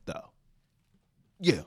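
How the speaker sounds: ordinary delivery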